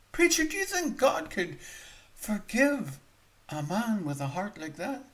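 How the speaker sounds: high, pleading cry to the preacher; a broken man pleading with the preacher; desperate plea. Acted. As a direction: beg the preacher for forgiveness.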